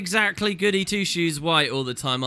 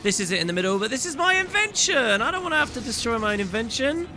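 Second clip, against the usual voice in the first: high-pitched, hammed-up dismay; mock wailing protest; 6 semitones higher than usual. Gamer wailing over having to destroy the invention, mock dismay. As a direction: whine it in comic distress.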